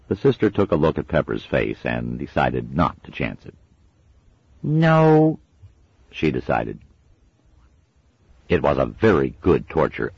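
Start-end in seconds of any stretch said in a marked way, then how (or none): 4.6-5.4 high small reluctant no; a child choosing safety; little girl. A narrator's reading. Little sister giving in.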